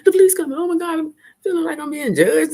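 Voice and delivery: high, loud panicky repeats; frantic about the police, hammed-up; imitating her own high panic; mock paranoid panic. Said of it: "I'd be like".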